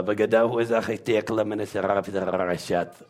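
letters chained in one unbroken stream; all run together. Shown as a demonstration. Putting the alphabet together, run-on recital. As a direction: roll straight through the syllables without gaps. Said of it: "You put them all together".

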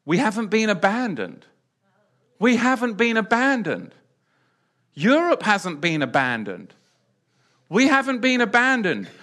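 impassioned pulpit declaration; fervent preaching. Real, not acted. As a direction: proclaim it to the congregation. Preacher repeating reassurance loudly.

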